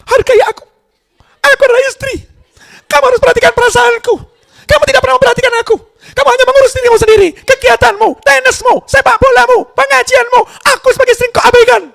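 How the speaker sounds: mock woman's complaining falsetto; nagging wife. Istri menuntut dengan suara perempuan. (istri menuntut suami dengan suara tinggi melengking)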